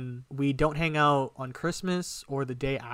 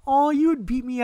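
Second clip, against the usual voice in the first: much higher, louder, sugary teasing voice; cutesy girlfriend; 11 semitones higher, 6 dB louder than usual. Retold or imitated. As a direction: play her as coy and cute, pitched way up. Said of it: "she pulls this whole cutesy".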